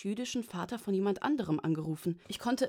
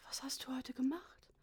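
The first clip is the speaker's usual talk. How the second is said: put-on high, whispery old father; almost inaudible; 7 semitones higher, 7 dB quieter than usual. A narrator's reading. Father asking his daughter on the phone, almost inaudible. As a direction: let the question barely come out, thin and whispered. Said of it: "mit beinahe unhörbarer Stimme".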